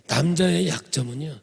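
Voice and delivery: ordinary talk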